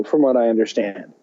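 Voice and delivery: ordinary delivery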